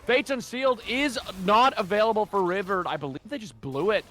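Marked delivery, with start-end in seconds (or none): 0.1-2.8 high-pitched, urgent fight call; live play-by-play. Real, not acted. Caster follows Guster Posey through the fight, excited play-by-play. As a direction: rattle through the team fight at a raised pitch.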